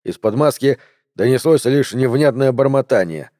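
ordinary delivery, nothing marked

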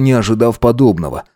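ordinary delivery, nothing marked

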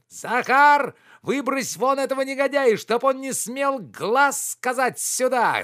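very high, loud bellowed order; furious shouting. A narrator's reading. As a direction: roar for the servant to throw him out. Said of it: "закричал Обломов". (яростно кричать во весь голос)

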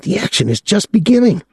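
put-on high, excitable young man's voice; a gawking kid, pitched high; young Claude, leering. A narrator's reading. Claude eager.

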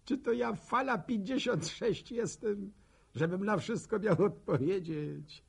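high, loud rhetorical question; indignant protest. Acted. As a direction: protest at being expected to know everything. Oburzone pytanie retoryczne w monologu. (głośny, oburzony protest wysokim głosem)

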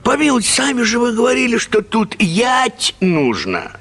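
put-on high, rushed boy's protest; pleading schoolboy. Boy begging the teacher not to flog him, high and pleading. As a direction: plead as a wronged pupil. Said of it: "Опять пороть".

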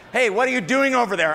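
a friend calls out to the negative one; calling over. Acted. Someone calls out in skit.

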